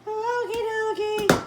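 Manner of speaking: high, slow singsong okie dokie. Acted. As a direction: sing it out cheerfully.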